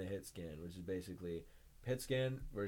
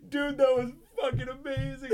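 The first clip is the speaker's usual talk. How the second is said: loud, high hyped praise; laughing, cheering excitement; amazed teammates; 14 dB louder than usual. Retold or imitated. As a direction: gush like a thrilled teammate.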